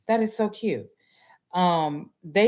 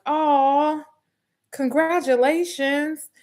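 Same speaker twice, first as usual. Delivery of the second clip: high, drawn-out aw congratulations; cooing congratulations; 9 semitones higher, slower than usual. Retold or imitated. Classmates congratulating the graduate, a high slow coo.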